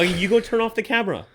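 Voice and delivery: high, loud, fast playful command; mock banishing order. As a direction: banish him from the room, hammed-up. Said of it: "that was banishing you".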